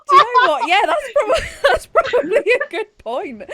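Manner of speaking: high, cracking through laughter; laughing. Real, not acted. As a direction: squeal it while laughing helplessly. Laughing retelling her reply.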